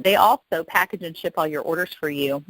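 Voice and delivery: ordinary delivery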